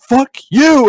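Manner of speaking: very high, loud comic yell; panicked refusal yell. Retold or imitated. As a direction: shout it over your shoulder while fleeing, hammed-up. Acting refusal while fleeing.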